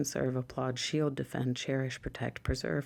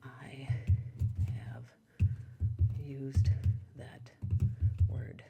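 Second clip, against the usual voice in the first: whispered example comment; slower than usual. Shown as a demonstration. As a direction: whisper it slowly, word by word, like a secret. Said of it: "putting in the comments would be".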